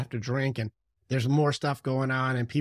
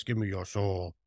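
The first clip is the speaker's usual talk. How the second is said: lightly voiced low devil growl; a hint of a hammy devil, pitched down; low, half-hearted villain demand; mock devil; 5 semitones lower, 4 dB quieter than usual. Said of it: "he wouldn't be like this".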